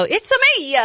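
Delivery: high-pitched cartoon Italian accent; hammed-up Italian greeting; mock Italian. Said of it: "I have Italian".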